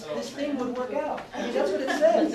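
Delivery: loud, high-pitched exasperated protest; indignant outburst. Retold or imitated. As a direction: insist at the top of your voice, exasperated. Acts out his indignant younger self.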